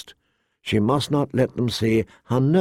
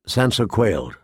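ordinary delivery

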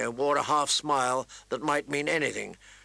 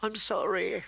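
high, tearful plea; higher voice, acted sobs; sobbing apology; 7 semitones higher than usual. A narrator's reading. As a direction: act out a small, sobbing girl. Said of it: "she sobbed".

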